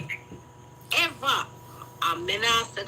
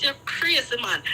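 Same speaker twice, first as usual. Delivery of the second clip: normal talking voice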